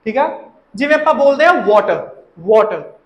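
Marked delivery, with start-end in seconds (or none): 1.5-3.0 वाटर as "WAW-tuh"; "aw" sound. Shown as a demonstration. Teacher giving the usual form, वाटर as "WAW-tuh". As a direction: round the vowel of वाटर, no final r. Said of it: "ओ दी sound आंदी".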